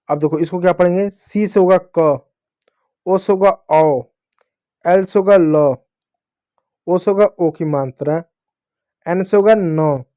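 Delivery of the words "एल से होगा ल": ordinary delivery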